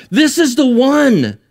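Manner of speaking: high, slow, drawn-out exclamation; acted delight. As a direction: proclaim it as Adam, marvelling at his discovery. Acts out Adam seeing the woman.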